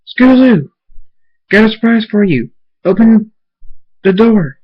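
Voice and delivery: high, loud call through the door; excited yelling to the kids' room. Acted. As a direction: shout for her to come open up. Father calling daughter from outside door.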